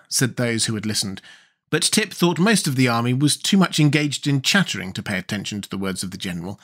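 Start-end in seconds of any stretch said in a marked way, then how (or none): none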